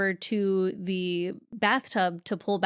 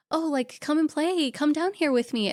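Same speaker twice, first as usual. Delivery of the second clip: higher, coaxing feminine ghost; high, teasing invitation to play; playful voice; 7 semitones higher than usual. Acted. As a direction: lure him in playfully. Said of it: "one being a little bit more playful".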